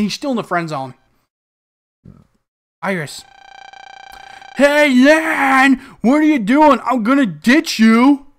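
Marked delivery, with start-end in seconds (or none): 4.6-8.3 put-on high, loud kid calling across the street. Player voices Mick shouting at Lan, high and loud. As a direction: yell it like an impatient friend.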